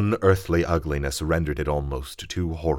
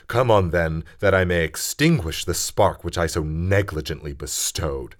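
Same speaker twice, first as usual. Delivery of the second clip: furious defiance; 6 dB louder, slower than usual. Acted. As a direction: hurl the curse at the monster. Frankenstein rages at the creature.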